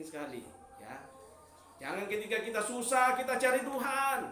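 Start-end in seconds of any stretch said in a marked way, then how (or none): 1.8-4.2 rising, louder sermon voice; preaching. Acted. Preacher urging the church to give thanks, loud and rising. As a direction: preach it out to the congregation.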